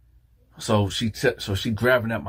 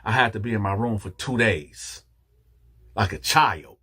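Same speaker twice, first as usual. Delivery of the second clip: usual speaking voice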